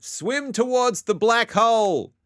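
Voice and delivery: high, loud, stretched-out cry; dramatic call. Acted. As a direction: proclaim it slowly at full volume. Dramatic chanted call in game.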